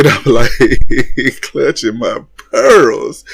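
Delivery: slow words broken by laughter; laughing. Real, not acted. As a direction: laugh through it, barely getting words out. Laughing at reunion drama.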